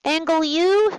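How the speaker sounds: normal talking voice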